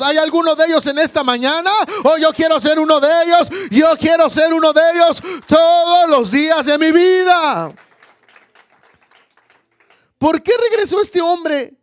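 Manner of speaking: high, loud, fast, shouted declaration; full-force preaching. Real, not acted. Preacher declaring he wants to be one of them, full-force preaching. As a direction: cry it out fervently, stretching the ending.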